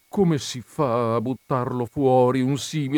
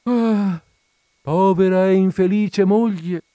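sighing pity; 5 semitones higher, 5 dB louder than usual. Acted. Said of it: "sospirò la colonnella".

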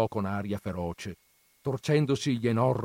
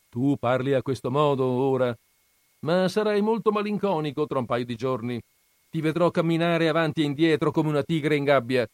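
lightly voiced grandmother, raised and quicker; teasing grandmother; 6 semitones higher, 6 dB louder, faster than usual. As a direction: needle him knowingly. Grandmother teases husband. (nonna che punzecchia il marito, voce alzata)

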